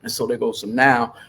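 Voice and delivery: normal talking voice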